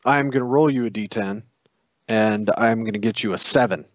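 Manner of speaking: usual speaking voice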